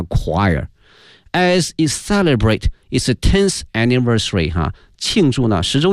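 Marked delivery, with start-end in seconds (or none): 0.0-0.7 "choir" opening with a k sound; pronunciation demo. Shown as a demonstration. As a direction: say "choir" with CH as a hard k. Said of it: "它是发k这个音哈".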